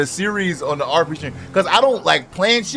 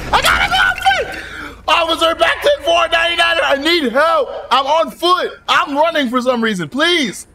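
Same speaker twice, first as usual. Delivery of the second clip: panicked; 6 semitones higher than usual. Acted. Cop radios panicked on foot.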